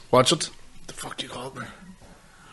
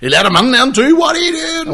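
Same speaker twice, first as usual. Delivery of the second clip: high, loud shout climbing in pitch; imitating a loud, high-pitched yell; retold shout; 14 semitones higher, 6 dB louder than usual. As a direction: holler it in mock alarm, much higher. Retold outburst after the window prank.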